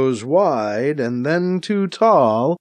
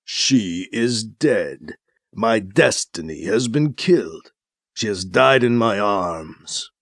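low, slow, grieving growl; violent growl; 6 semitones lower than usual. A narrator's reading. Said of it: "his voice a violent growl".

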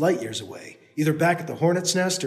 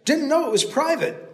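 calling; 10 semitones higher than usual. Acted. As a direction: still high. Continues shouted apology.

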